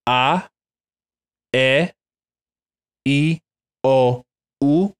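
A, E, I, O, U as pure Spanish vowels, ah eh ee oh oo, each held apart. Shown as a demonstration. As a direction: model each vowel slowly for repeating. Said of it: "you can say this after me".

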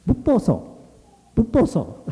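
very high, brisk name-call; friends calling out. A narrator's reading. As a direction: hail him in a high, piping voice. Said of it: "his friends began calling him".

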